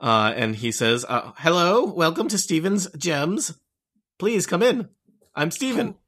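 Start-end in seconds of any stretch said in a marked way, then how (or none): 1.4-6.0 a hint of a cheerful little gnome greeting guests; gnome merchant. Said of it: "gnomish man".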